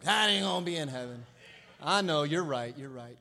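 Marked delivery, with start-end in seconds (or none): 0.0-1.2 lightly mimicking disapproving churchgoers; religious folk. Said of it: "You religious folk".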